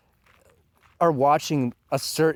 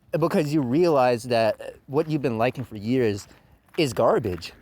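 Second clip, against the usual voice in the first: normal talking voice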